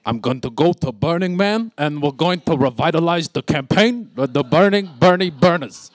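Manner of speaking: Bernie Sanders impression with a Brooklyn accent. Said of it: "so I could do the accent".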